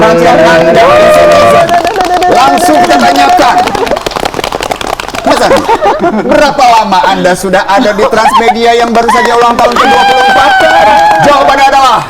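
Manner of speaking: loud, high quiz host voice; game show host. Acted.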